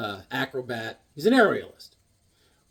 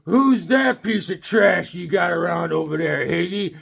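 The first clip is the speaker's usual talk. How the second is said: mock biker taunt; 2 semitones higher, 6 dB louder, slower than usual. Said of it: "some biker comes in and goes".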